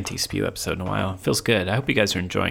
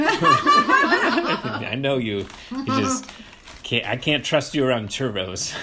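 laughing, much higher, stretched out; 4 semitones higher, much slower than usual. Real, not acted. Teasing her friend about the churros, laughing. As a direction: giggle the words out.

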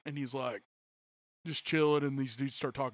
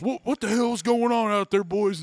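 higher, louder mock yell of a country dad; 6 semitones higher, 7 dB louder than usual. Seth's dad yelling out at the boys, mock shout. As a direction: call out like a dad hearing trouble outside. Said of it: "he goes".

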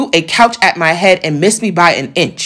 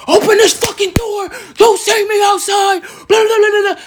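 mock yell pitched way up; imitated angry yelling; 12 semitones higher than usual. Man locked outside yelling at her through the door, imitated angry yelling. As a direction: imitate a man demanding to be let in.

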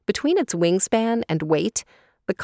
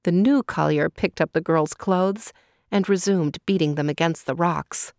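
ordinary delivery

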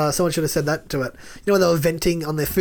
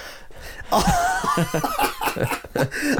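long, high burst of laughter; laughing; 5 dB quieter than usual. Real, not acted. Cracking up after a dodgy accent impression, laughing. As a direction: crack up helplessly.

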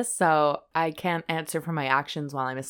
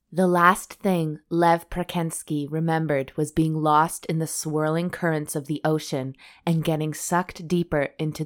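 dramatic book reading; 3 semitones lower than usual. Acted. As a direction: read it like a movie trailer. Reads book blurb.

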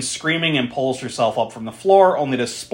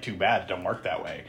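much softer, low dismissive taunt; soft creepy dismissal; quiet menace; 6 semitones lower, 10 dB quieter than usual. Retold or imitated. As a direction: shrug off her defiance coldly. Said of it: "he's like".

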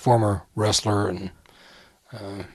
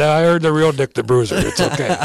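laughing reply; 5 semitones higher, 6 dB louder than usual. Retold or imitated.

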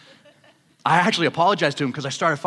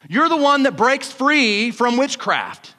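slow, louder preaching call; fervent preaching; 6 semitones higher, 3 dB louder, slower than usual. Acted. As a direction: preach it slowly and fully. Preaching climax.

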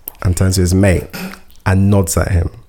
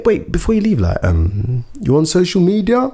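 high eager ask; 8 semitones higher than usual. Retold or imitated. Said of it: "He was like".

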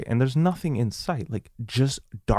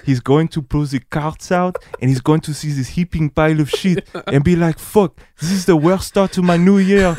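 imitated French dad, building higher and louder; French accent; 4 semitones higher, 5 dB louder than usual. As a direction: mimic a French father getting more and more worked up. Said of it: "saying with his French accent".

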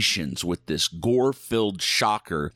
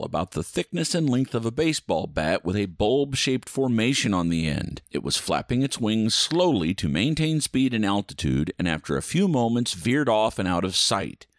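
normal talking voice

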